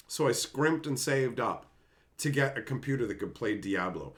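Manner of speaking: ordinary talk, nothing marked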